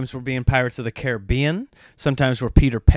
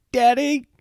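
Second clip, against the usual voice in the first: put-on high, louder little-kid call; imitating toddler; 13 semitones higher, 4 dB louder than usual. Two-year-old calling his dad. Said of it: "And he's like".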